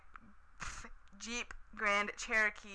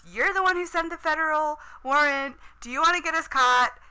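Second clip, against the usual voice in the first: high, loud, panicked; freaking out; 7 semitones higher, 10 dB louder than usual. Retold or imitated. Erica panicking at Sean about the warrant, loud and high. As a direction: blurt it out in a panic. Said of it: "she's freaking out".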